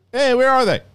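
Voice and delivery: high, loud call as a character arriving. Acted. Obi-Wan showing up to ask where they are, calling out.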